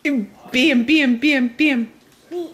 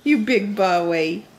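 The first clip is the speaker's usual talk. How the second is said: usual speaking voice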